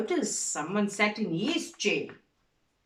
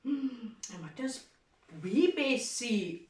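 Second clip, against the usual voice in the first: ordinary delivery, nothing marked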